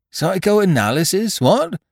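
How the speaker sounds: put-on high, loud startled young officer; alarmed. A narrator's reading. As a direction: blurt it out in alarm. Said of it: "with visible alarm".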